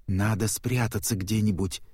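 ordinary delivery

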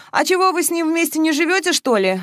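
high-pitched, casual-seeming question; feigned indifference. A narrator's reading. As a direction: ask as if it hardly matters. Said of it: "вроде бы равнодушно спросила Маша".

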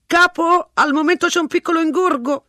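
hurrying the caller off; brusque policeman. A narrator's reading. Policeman cutting Mr Ransom off, brusque. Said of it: "lo interruppe la voce".

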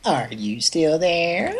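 slow, drawn-out recorded prompt; snippy voicemail voice. Retold or imitated. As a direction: mimic a snooty answering machine. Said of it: "the bitchiest voicemail recordings ever".

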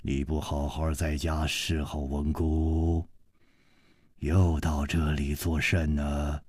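low, soft voice of quiet authority; deep, grave voice. A narrator's reading. Stern father asking his daughter why she came, deep grave voice. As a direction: speak low and measured, like one used to command. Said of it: "就听见一个人沉声说".

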